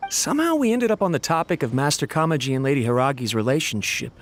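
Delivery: ordinary talk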